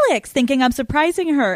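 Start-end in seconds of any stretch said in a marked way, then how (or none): 0.1-0.3 excited greeting. Retold or imitated. Daughter imitating mother surprised at the door, excited. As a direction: imitate a thrilled gasp of recognition. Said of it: "so excited".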